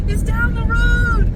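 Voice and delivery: high, slow mock yell; telling the owner where the dog is, hammed-up yell; retold shout. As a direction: stretch it out as if calling across distance. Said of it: "it's like".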